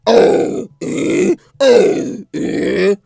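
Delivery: loud breathy bear huffs; bear grunts. Retold or imitated. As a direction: huff like a dancing bear. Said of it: "rears up on his hind legs and goes".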